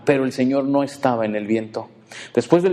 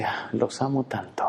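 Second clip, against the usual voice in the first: softer, sighing love; tender sigh; 6 dB quieter than usual. Retold or imitated. God sighing over childish prayers, soft and tender. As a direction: breathe it out like a patient father. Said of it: "el Señor suspira y dice".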